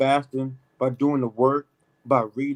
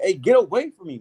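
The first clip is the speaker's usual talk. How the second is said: loud, higher rebuke as the Lord; the Lord turning latecomers away, raised and loud; Lord casting away; 5 semitones higher, 6 dB louder than usual. Retold or imitated. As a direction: push them off with a raised, higher voice. Lord voiced rejecting latecomers.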